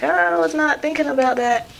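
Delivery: high-pitched, drawn-out protest; whiny comic realization; retold dismay. Retold dismayed reply to mom.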